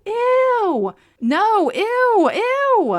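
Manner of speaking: very high, loud squealing ews; disgusted shrieks of ew. Real, not acted. Reader shrieking ew at the creepy age line, high and loud. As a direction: recoil in shrill disgust.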